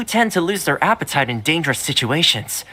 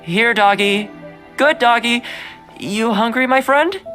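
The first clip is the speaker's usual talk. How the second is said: higher, sing-song call to a doggy; coaxing a dog; 8 semitones higher than usual. Acted. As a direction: gently lure a wary animal closer. Coaxing a wary dog with food.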